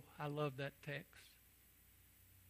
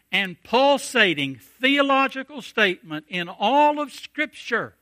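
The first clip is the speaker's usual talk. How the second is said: loud, higher-pitched fervent preaching; full-force preaching; 8 semitones higher, 13 dB louder, slower than usual. Acted. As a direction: lift the congregation with rising fervour.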